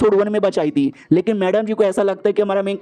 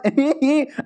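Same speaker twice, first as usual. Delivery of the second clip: mocking giggle; 7 semitones higher than usual. Girl laughs at delivery joke.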